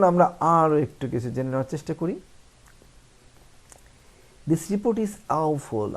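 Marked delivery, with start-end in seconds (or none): 4.5-6.0 rude. Shown as a demonstration. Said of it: "আমরা এটা বলবো না".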